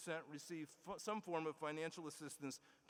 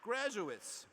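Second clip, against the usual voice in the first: usual speaking voice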